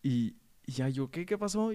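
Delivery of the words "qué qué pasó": put-on higher, innocent kid's reply. Boy answering his mother innocently, higher playing-dumb voice. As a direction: play dumb like a relaxed kid.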